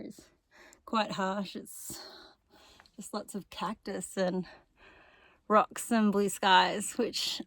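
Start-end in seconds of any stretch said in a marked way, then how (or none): none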